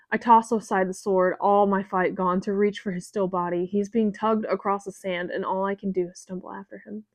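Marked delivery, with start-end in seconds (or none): none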